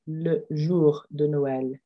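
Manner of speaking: le jour de Noël said very slowly; slow model. Shown as a demonstration. Teacher slowly modelling the French phrase for students. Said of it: "So la veille de Noël".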